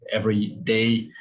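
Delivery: ordinary talk, nothing marked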